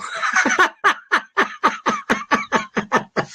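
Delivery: a long, high cackle of delight; high squealing laugh. Real, not acted. Laughing hard at granddad's punchline.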